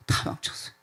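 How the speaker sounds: breathed out, barely voiced; despairing whisper. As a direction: let the last words die into a whisper. Peter's despairing confession ends. (절망하며 속삭이듯 내뱉는 말)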